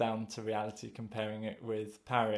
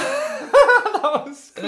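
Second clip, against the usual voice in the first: laughing; 15 dB louder, much slower than usual. Real, not acted. Laughing after singing.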